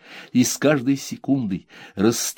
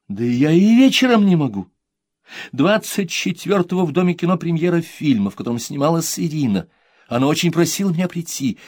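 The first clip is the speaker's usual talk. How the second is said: mother, loud and high, protesting; 7 semitones higher, 4 dB louder than usual. Acted. Mother to son, protesting her schedule. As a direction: explain the clash in mock despair.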